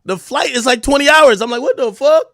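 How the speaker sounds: loud, high exasperated rant; loud comic outrage; outraged. Real, not acted. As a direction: shout it in disbelief. Outraged at twenty-hour flight.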